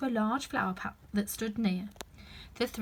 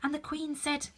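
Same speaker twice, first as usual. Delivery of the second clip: higher, softer nervous rabbit; anxious rabbit; 6 semitones higher, 3 dB quieter than usual. Acted. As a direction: fret quietly.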